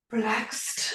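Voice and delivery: breathy whispered word; relaxed whisper. Real, not acted. Comfy on the couch, whispering how relaxed she feels. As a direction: let it out in a whisper, sinking into the couch. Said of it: "I feel very".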